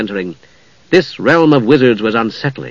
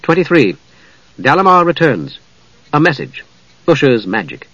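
ordinary delivery, nothing marked

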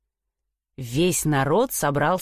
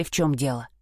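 ordinary delivery, nothing marked

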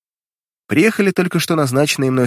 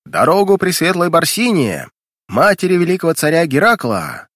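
town crier; 6 semitones higher than usual. A narrator's reading. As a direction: call out to clear the crowd. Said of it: "Под эти крики".

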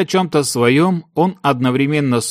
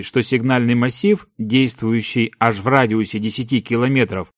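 usual speaking voice

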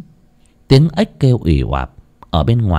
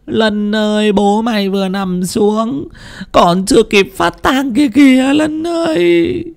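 high, slow keening wail; wailing lament; 13 semitones higher than usual. Acted. Widow wails over her dead husband and drugged son, slow and high. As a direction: mourn aloud as if your heart is breaking. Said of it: "bà lại khóc lóc".

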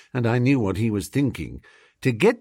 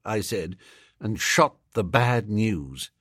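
ordinary delivery, nothing marked

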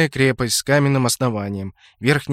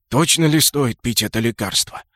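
plain reading voice

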